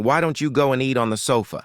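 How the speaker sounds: ordinary delivery, nothing marked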